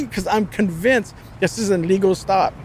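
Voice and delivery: ordinary delivery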